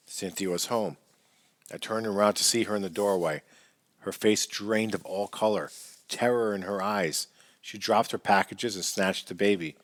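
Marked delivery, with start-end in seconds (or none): none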